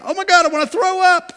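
high, loud wail of regret; mock sick panic. Life gone from enjoyment to regret, loud mock sick panic. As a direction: cry out as if about to be sick. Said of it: "Your life can go from".

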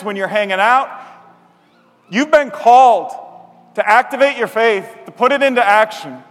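rally the congregation to act; fervent preacher's call to go; preaching exhortation. Acted.

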